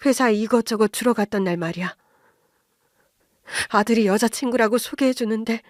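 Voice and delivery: usual speaking voice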